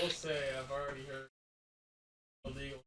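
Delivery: distant and muffled, like through a wall; a voice from the next room, faint; far-off voice. Real, not acted. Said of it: "How do you sound so far away?".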